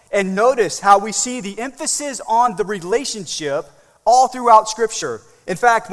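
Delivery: driving pulpit delivery; impassioned preaching. Real, not acted. Preaches at full force.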